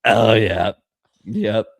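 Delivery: lightly voiced, knowing principal; changed-tone principal. Host mimicking the principal's change of tone, lightly hammed-up. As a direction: punch the first words as the pleased principal. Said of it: "the change in tone and his pitch of his voice".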